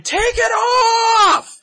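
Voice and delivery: screaming fan heckle; retold scream. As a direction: belt it out like a rowdy fan heckling. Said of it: "This lady just starts screaming".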